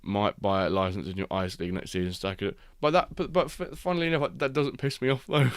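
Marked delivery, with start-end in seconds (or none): 2.8-5.5 excited. Real, not acted. Excited aside.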